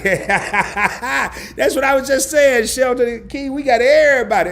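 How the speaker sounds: laughing hard and loud. Real, not acted. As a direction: talk through big laughs. Laughing over chat names.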